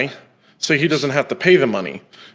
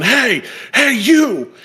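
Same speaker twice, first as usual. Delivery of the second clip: high imitated yell of Hey, you; a stranger rushing the porch, calling out high; mock calling out; 9 semitones higher, much slower than usual. Man running off the street toward the porch, yelling up high.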